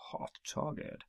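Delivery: hushed, whispered repeat of a title; whispered echo. Acted. Host echoing an episode title for effect, whispered. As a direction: drop right down into a whisper.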